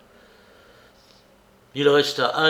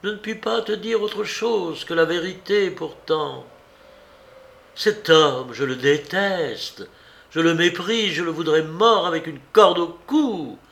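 hateful confession; 6 semitones higher, 4 dB louder than usual. A narrator's reading. Monique confesses her hatred.

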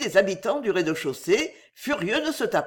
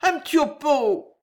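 high wheedling exclamation; high, insistent drunken plea; stubborn repetition; 7 semitones higher than usual. A narrator's reading. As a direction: harp on it like an obstinate drinker. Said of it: "répétait son mot, toujours le même".

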